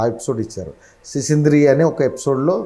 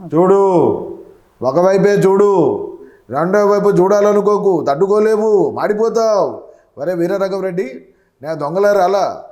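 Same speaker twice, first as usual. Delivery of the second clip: Balakrishna impression; 7 semitones higher than usual. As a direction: thunder the villain warning. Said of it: "అలా బాలకృష్ణ గారు".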